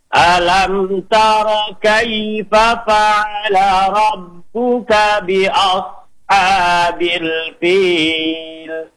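slow melodic tartil chant; teacher modelling tajwid recitation; Quran recitation. Shown as a demonstration. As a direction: recite it measured and sung.

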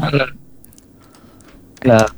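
choppy phone line, words dropping; bad connection. Real, not acted. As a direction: voice cutting out on the call. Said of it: "Aduh, suara putus-putus nih".

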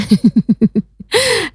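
light giggling laugh; short rippling chuckle. Real, not acted. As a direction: laugh at your own craving. Joking about wanting a snack.

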